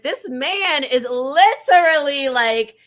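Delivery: high, loud and slow, in disbelief; mock incredulous rant. To her listener, mocking her old self, high slow disbelief. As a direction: stretch it out, aghast at the price. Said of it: "And I was like".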